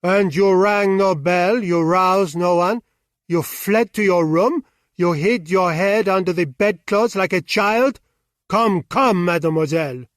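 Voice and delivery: loud, scornful mockery; mock her cowardice; biting sarcastic scolding; savage irony. A narrator's reading. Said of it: "his savage irony".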